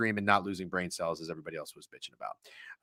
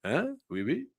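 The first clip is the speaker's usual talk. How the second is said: French painter; 5 dB quieter than usual. Acted. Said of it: "I really do feel like a painter".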